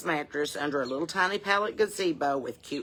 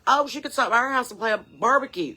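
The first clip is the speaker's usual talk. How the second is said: mock eager fan; 7 semitones higher, 5 dB louder than usual. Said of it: "if you're like".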